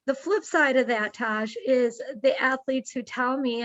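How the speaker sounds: usual speaking voice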